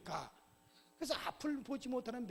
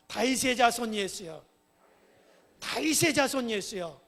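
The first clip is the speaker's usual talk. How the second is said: crying out; 11 dB louder than usual. Acted. Blind beggar calls out to Jesus in the passing crowd, crying out. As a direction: shout it out with a falling end. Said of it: "뭐라고 소리를 질렀어요?".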